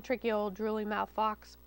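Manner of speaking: ordinary delivery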